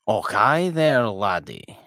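hammed-up Scottish burr, slow and loud on Och; mock Scottish greeting, overdone. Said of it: "saying absurdly stereotypical things".

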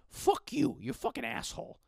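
high Marge Simpson-like mom cursing; mock cursing mother; sweary mom. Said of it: "my mom swears a lot".

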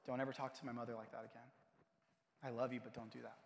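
low, soft and quick admonishment; quiet firm warning. Retold or imitated. Brother defending his mother to grandmother, quietly firm. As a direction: keep the reproach hushed and low.

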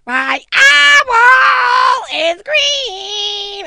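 put-on high, very slow, loud kid's shout; child yelling. Little son shouting to his parents at dawn, drawn-out yell. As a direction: stretch it out like a toddler yelling at dawn. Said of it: "I was like".

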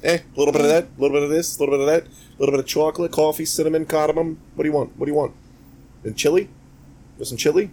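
high, sing-song mock New Yorker; hammed-up New York food seller; bad New York accent. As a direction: rattle off a list in fake New York. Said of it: "do the shitty New York accent".

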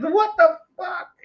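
very high, drawn-out disbelief; hammed-up shocked falsetto; mock outrage. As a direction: squeal it like an appalled dad. Dad finds mess in house.

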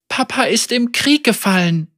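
put-on high, trembling girl's voice; a child fighting not to cry; holding back tears. A narrator's reading. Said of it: "das Zittern aus ihrer Stimme zu verbannen".

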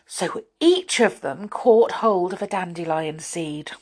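ordinary delivery

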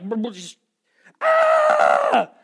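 stammer, then a high, loud yell of frustration; frustrated scream. Retold or imitated. Husband losing it in front of his wife, sputtering scream. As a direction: sputter and burst into a wordless shout. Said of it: "And I'm like".

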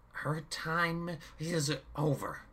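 slow, high menacing villain. A narrator's reading. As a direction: pronounce it coldly. Chaos replies.

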